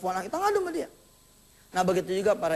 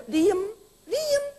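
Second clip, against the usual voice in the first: sharp rising shush, higher each time; mock husband shushing; 12 semitones higher than usual. Husband hushing his wife, sharp and high. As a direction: cut her off with a quick hush.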